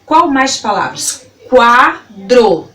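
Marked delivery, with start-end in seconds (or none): 1.5-2.7 QUADRO split into qua - dro; syllable by syllable. Shown as a demonstration. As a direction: sound out QUADRO in two clear beats. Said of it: "falando elas pausadamente pra você".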